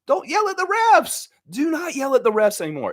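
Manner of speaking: high, insistent don't; emphatic instruction. Real, not acted. Telling Scott not to yell at refs, insistent. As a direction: lay down the rule to Scott. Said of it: "telling Scott".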